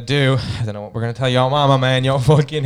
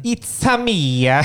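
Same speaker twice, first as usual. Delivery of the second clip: high, drawn-out mock Italian; Italian accent; 7 semitones higher, much slower than usual. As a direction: ham up an Italian accent, stretching the vowels. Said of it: "Do Italian".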